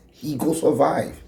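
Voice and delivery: ordinary talk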